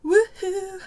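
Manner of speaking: high loud woohoo; excited cheer. Real, not acted. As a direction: cheer on cue. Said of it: "Perth Festival of Yarn".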